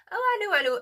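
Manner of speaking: sudden high, loud "I knew"; thrilled to be proven right; high-pitched burst of delight; excited realization. Real, not acted. Card confirms her shirt.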